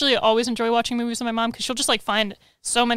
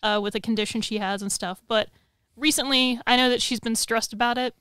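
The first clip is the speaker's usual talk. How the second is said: ordinary talk, nothing marked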